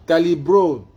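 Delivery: high, loud insistence on the name; loud correction. Real, not acted. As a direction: correct the assistant loudly.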